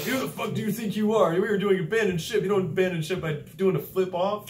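high, loud angry scolding; instructors yelling. Retold or imitated. Instructors chewing out a recruit who flipped off: high angry yell. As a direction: chew him out like a furious instructor.

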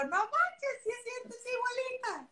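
high, amazed exclamation; sudden surprised recognition; amazed gasp. Retold or imitated. Host seeing the guest's resemblance, high amazed exclamation. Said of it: "pero te veo y digo".

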